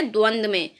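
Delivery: ordinary delivery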